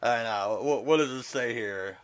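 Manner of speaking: normal talking voice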